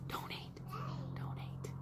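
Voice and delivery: hushed, voiceless mock-subliminal plug; playful whisper. Streamer slyly prompting viewers to give money, playful whisper. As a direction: whisper it like a secret nudge.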